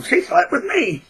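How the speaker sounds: very high, put-on voice; exaggerated high-pitched lying claim; mock tattling. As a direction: squeal it like a mocked tattletale. Mimics liar telling on someone.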